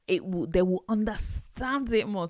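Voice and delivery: lightly put-on Nigerian accent. Shown as a demonstration. Mum explaining how she tells children off, Nigerian accent. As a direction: lean into a Nigerian accent for emphasis. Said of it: "put on a Nigerian accent".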